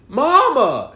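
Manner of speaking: mom calling her own mother, high and loud. Retold or imitated. Mom phoning grandmother, calling out Mama. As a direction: call out to Mama across the house. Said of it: "she was like".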